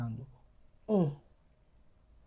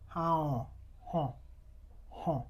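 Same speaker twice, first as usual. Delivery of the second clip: nasal open sound demo; 4 dB quieter, slower than usual. Shown as a demonstration. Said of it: "That's exactly how you make that sound".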